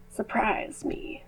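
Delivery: eerie whisper. Acted. Character's ominous teasing reply.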